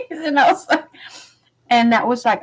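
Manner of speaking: normal talking voice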